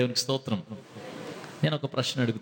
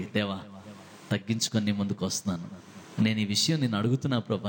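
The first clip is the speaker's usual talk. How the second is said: humble prayer; 7 semitones lower than usual. Retold or imitated. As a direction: pray meekly. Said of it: "తగ్గింపుతో దేవుని దగ్గరికి వస్తే". (తగ్గింపుతో మెల్లగా ప్రార్థన)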